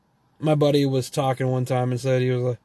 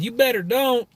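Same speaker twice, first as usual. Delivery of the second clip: put-on southern twang, pitched up; 10 semitones higher than usual. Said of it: "he is not a redneck".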